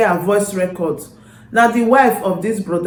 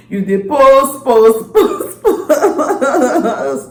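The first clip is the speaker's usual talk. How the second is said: laughing; 6 semitones higher, 4 dB louder, much slower than usual. Real, not acted. Laughing playful teasing.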